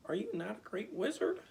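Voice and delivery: high, loud astonished question; can't believe he's no wizard; astonished girl. A narrator's reading. Dorothy shocked in read story.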